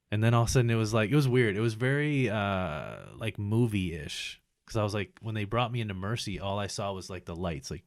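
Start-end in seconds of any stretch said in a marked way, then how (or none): none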